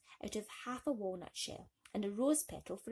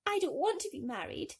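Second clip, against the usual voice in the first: higher begging voice; mild tearful plea; 9 semitones higher, 3 dB louder than usual. Acted. Thumbelina telling the fishes, higher tearful plea. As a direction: protest like a frightened child. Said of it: "she pleaded".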